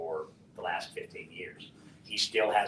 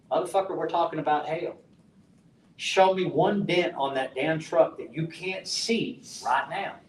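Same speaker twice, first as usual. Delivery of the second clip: raised, higher, slowed-down retold rant; heated yelling at the insurance adjuster; angry outburst; 7 semitones higher, 5 dB louder than usual. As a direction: let the anger boil over as you retell it.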